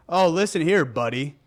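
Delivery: Oh, listen here, buddy with buddy leaned on mockingly; patronizing coworker, stressed buddy; condescending emphasis. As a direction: talk down, lean on buddy. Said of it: "there's such an emphasis on buddy".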